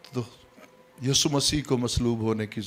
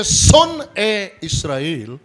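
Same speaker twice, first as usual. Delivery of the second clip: slow, raised scriptural call; proclaimed command; 7 semitones higher, 6 dB louder, much slower than usual. Retold or imitated. Preacher voices the Lord calling Israel, loud and slow. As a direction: call out to a whole nation, drawn out. Acts the command given back then.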